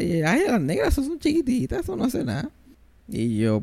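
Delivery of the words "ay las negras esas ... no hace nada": dismissive mother. Retold or imitated. Mother dismissing small snakes.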